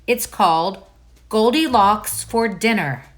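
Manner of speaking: ordinary delivery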